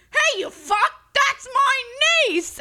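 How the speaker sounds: loud, high outraged bellow; full-volume acted outrage; imitated angry shout. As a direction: yell it across the street like a protective uncle. Uncle shouting at catcallers.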